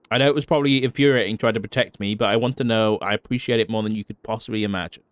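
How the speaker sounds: ordinary delivery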